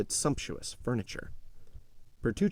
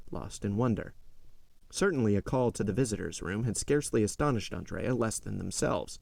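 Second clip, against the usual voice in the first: plain reading voice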